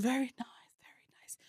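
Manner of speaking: voiceless very nice; hushed imitation of the lady; whispering the compliment as the lady; whispered mock lady.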